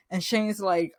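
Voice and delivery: ordinary talk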